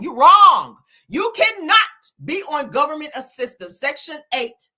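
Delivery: a sudden loud, pointed scold. Real, not acted. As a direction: bark it right at them. Scolding people who commit fraud.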